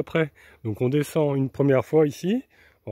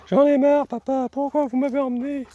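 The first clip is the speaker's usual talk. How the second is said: high whiny voice of a tired dog; 12 semitones higher, 4 dB louder than usual. Pretend. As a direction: complain like a sulky puppy. Dog given a voice by owner.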